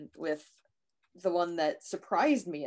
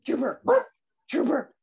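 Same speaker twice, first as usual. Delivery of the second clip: higher-pitched, short calls of a name; calling the dog; 8 semitones higher, 5 dB louder than usual. Real, not acted. Owner calling Trooper to settle down, higher-pitched call. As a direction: get the dog's attention, higher each time.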